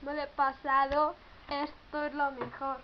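loud, slow victory boast; triumphant. Real, not acted. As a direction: gloat over beating the level. Beating the level.

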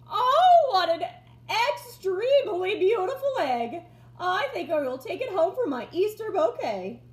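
delighted gushing. A narrator's reading. Mabel Mayberry finds the disguised Turkey's egg, gushing with delight. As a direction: swoon over it like a treasure.

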